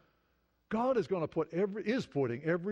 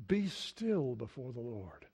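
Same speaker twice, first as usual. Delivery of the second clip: solemn scripture reading; 5 semitones lower, slower than usual. Acted. Quoting scripture, slowed and solemn.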